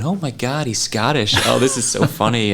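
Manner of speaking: mock American accent, delighted; imitated American fans. American fans reacting to a Scottish comic, in mock American accent. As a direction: put on an American audience member's accent. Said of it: "they're going".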